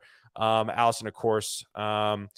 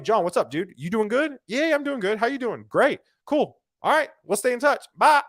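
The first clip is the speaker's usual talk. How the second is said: high, breezy two-voice dude chat; quick bro chat; 8 semitones higher than usual. Retold or imitated. Recruiter mimicking a short chat with John, bright and high. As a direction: toss off a quick hey-bye chat. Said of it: "if I saw John Lahari".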